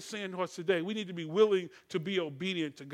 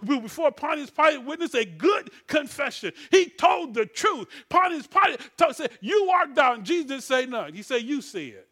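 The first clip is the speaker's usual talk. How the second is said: raised, emphatic scripture preaching; full-force preaching; 4 semitones higher, 6 dB louder than usual. Real, not acted. Preacher charging the congregation to fight, full-force preaching. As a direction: drive the verses home, pitched up and loud.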